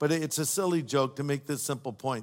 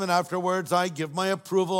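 ordinary talk

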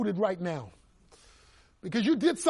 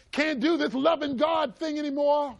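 despairing outcry; 6 semitones higher than usual. Acted. Peter voiced in despair.

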